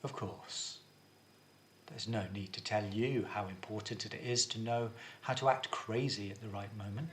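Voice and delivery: composed and convincing, voice kept low and quiet; calm tone. Shown as a demonstration. Drama teacher demonstrating the maniac being convincing, calm. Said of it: "in a calm tone of voice".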